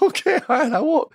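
high, squeaky mock-meek voice; meek backing down. Newcomer warned by a veteran, meekly agreeing. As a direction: give in with a tiny high voice.

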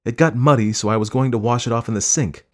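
slightly indignant explanation; defensive. A narrator's reading. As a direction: explain yourself in a quarrel. Wife defends herself in quarrel.

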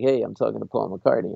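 cool, casual and offhand; suave, composed name-drop; smooth and hip. Retold or imitated. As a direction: play it smooth, as if it's no big deal. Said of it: "I'm all smooth and hip".

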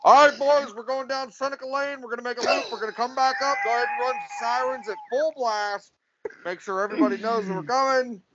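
crew leader's orders. Acted. Telling the boys to go down Seneca Lane, lightly acted crew orders.

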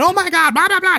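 high, loud imitated panic; mock freak-out. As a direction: imitate someone suddenly losing it, high and loud. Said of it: "She starts freaking out".

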